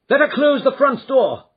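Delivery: loud, high urgent command; urgent shout. A narrator's reading. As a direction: call out the order fast. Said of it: "cried Holmes".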